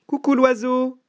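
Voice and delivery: high mouse call; calling out greeting. Acted. Mouse greeting the owl in its tree, high call. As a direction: hail the bird in a high voice.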